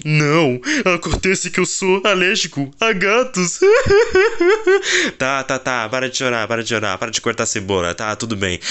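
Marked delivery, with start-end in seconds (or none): 3.6-5.1 squeaky high cackle; high laugh. Acted. Dad covering his tears with a high laugh. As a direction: burst into a shrill giggle.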